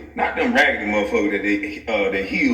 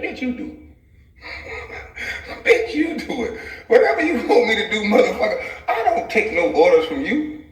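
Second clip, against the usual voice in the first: laughing retort; 10 semitones higher than usual. Real, not acted.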